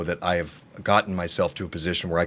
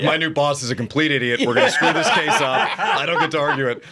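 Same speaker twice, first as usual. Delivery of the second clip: laughing mock complaint; 5 semitones higher than usual. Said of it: "was like".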